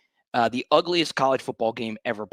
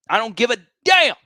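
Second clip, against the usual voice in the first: "damn" hit higher and louder; louder defiance; 8 semitones higher, 8 dB louder than usual. Real, not acted. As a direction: punch the word "damn".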